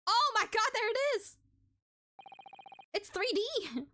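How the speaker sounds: very high, delighted exclamation; real thrill, pitch shooting up; excited outburst. Real, not acted. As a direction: squeal it out high in amazement. Delighted reaction as evidence appears.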